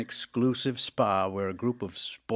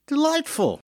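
mock delight; 9 dB louder than usual. Sardonic delighted exclamation.

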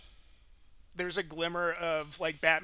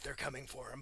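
hushed, lower urgent line; a lightly hinted vigilante voice, very soft; Batman; 4 semitones lower, 14 dB quieter than usual. Retold or imitated. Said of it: "he's speaking in the Batman voice".

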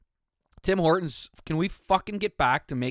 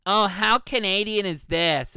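higher, louder gushing mimic of a poster; mock Instagram girl; 5 semitones higher, 5 dB louder than usual. Host mocking Instagram posters, lightly voiced gushing girl. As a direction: lightly parody a gushing social media post. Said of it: "on Instagram or Facebook".

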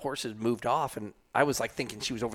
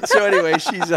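words squeezed out through laughter; laughing; 6 semitones higher, 11 dB louder than usual. Real, not acted. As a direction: try to go on while still laughing.

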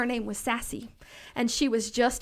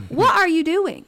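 raised, louder second ask; louder repeat; 7 semitones higher, 9 dB louder than usual. Retold or imitated. Mother asking her son again, louder. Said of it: "repeats the question louder".

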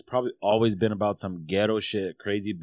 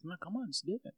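pushy friend; 11 semitones higher than usual. Retold or imitated. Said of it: "He's like".

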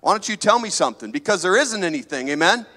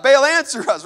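louder, higher mock plea; crying out; 6 semitones higher, 6 dB louder than usual. Preacher acting the prophets calling Baal, a mock cry. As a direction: call out to a silent god, half-acted. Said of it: "They were crying out".

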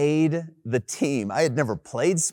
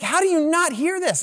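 incredulous; 4 dB louder than usual. Retold or imitated. Exasperated disbelief at friend.

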